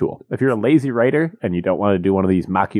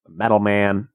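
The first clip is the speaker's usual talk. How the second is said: ordinary delivery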